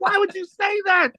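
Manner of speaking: high, strained mock wail of embarrassment; embarrassed outcry. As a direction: replay the mortified kid crying out at Fred. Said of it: "I was so embarrassed".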